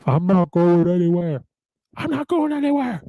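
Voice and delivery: loud, rising movie-speech holler; Wall Street movie impression. Imitating the defiant Wall Street speech, hammed-up high holler. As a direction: belt it out as a hammed-up rallying cry. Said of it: "like on Wall Street".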